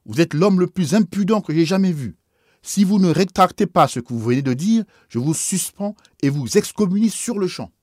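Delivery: high, loud furious outburst; outraged cry. Acted. Bishop crying out in fury at the priest's insult, high and loud. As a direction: explode at the insult. Bishop threatens excommunication in rage.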